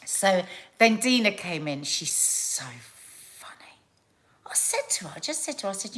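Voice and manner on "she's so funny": whispered aside; hushed confiding aside. Real, not acted. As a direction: drop to a secret whisper, slowly. Whispered aside about Dina.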